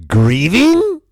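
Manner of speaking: high, loud churchy sign-reader voice; mock preacher voice. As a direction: read the banner like a booming, high-pitched church pitch. Reading church banner aloud.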